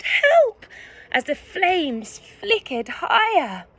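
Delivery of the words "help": very high, loud scream; scream for help. A narrator's reading. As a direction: scream in panic. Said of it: "Betty screamed".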